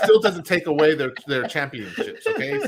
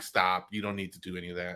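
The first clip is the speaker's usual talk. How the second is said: curt low brush-off; 7 semitones lower, 9 dB quieter than usual. Retold or imitated. He replays shutting down his chatty barber, low and quick. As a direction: lower and softer, fast. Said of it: "I'm like".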